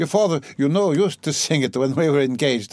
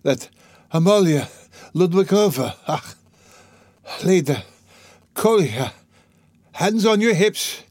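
high, broken bursts with gaps between phrases; gasping delirium; 8 semitones higher than usual. A narrator's reading. Delirious mother orders children to dance, high gasping bursts. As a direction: call out high and haltingly, as if gasping. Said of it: "gasping after each word".

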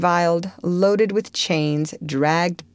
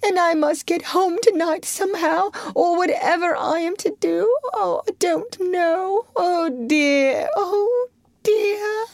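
much higher, tearful put-on woman's voice; crying washerwoman; 12 semitones higher than usual. A narrator's reading. Toad as washerwoman begging the driver, high tearful voice. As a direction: sob out the sad tale in a high disguise. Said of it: "crying afresh".

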